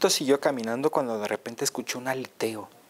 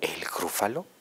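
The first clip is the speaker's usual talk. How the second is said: hushed whisper; 3 semitones lower, 6 dB quieter than usual. Acted. Mouse whispers the Gruffalo's name.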